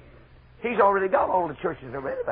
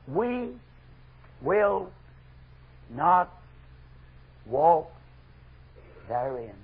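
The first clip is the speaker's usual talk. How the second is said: one word at a time, long pauses between; defiant refusal; much slower than usual. Acted. Preacher quoting the people refusing Jeremiah, slow defiant refusal.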